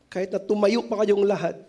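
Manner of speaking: ordinary delivery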